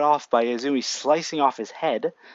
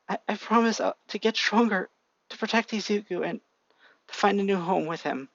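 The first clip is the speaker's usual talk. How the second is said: crying promise; 6 semitones higher than usual. A narrator's reading. Little sister promising her dad while crying. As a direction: sob out the promise. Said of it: "looks up crying".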